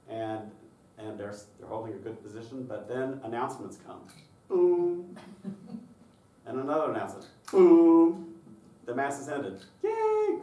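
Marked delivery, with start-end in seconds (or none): 4.5-5.2 long loud "boom"; booming thud. Acted. Imitated thud of announcements landing. 7.5-8.3 big loud "boom", held long; very loud drawn-out boom; booming thud. Acted. Imitated thud of announcements landing. 9.8-10.4 high, loud "yay"; mock cheer. Mock cheer when Mass ends.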